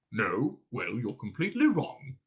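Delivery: lightly scratchy alien contradiction; Vogon. A narrator's reading. Vogon stands up and corrects them, scratchy creature voice. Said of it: "His voice took on the quality".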